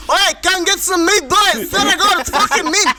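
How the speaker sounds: mock Greek uncle calling the boys over for meat; lightly done Greek Aussie accent; Greek barbecue guy. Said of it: "Imagine him on the fucking barbecue".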